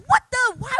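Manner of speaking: sudden loud shocked yelp; indignant shout of surprise; startled outcry. Retold or imitated. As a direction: cry out as if just shoved. Pushed on the street, reacting.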